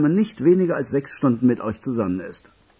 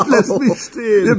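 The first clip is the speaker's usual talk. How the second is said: higher and louder through his own laugh; 8 semitones higher, 5 dB louder than usual. Acted. Telling it while laughing.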